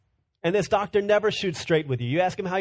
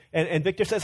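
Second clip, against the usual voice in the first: ordinary talk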